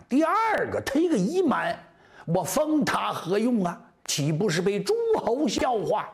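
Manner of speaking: high, declaimed royal objection; acted ruler spurning a lowly petitioner; scoffing emperor. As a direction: pitch it high as a dismissive rhetorical question. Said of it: "周天子乐了".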